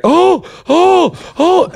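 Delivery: high, breathy-sounding mock gasps from onlookers. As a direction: squeak out a camp little oh, oh, oh.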